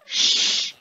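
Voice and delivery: long, forceful shushing hiss; sharp unvoiced shush; loud shush. Real, not acted. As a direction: shush them loudly. Shushes someone.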